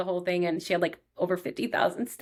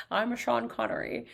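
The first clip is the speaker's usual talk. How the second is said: mock Sean Connery; 3 semitones higher, 4 dB quieter than usual. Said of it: "feel like Indiana Jones and his dad".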